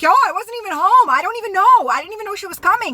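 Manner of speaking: high, loud-ish mock protest; imitating panicked man. Man at gunpoint denying involvement, imitated high protest. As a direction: imitate him pleading innocence in a high voice. Acted guy facing a shotgun.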